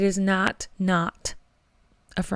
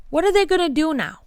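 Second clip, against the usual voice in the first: worked up; 8 semitones higher, faster than usual. Real, not acted. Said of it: "I'm getting worked up".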